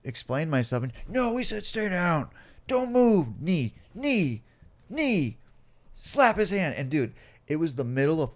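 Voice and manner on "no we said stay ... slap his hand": loud, high-pitched shouted orders; police commands. Acted. Cops to man they are kneeling on, shouted commands. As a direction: call out each order forcefully. Acting police pinning him down.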